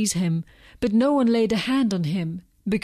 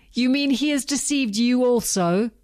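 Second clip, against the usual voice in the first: mildly indignant, raised questioning retort; sharp retort; 4 semitones higher than usual. A narrator's reading. Pharisees challenging the temple guards, with a mild retort. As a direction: retort in higher-pitched disbelief. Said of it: "the Pharisees retorted".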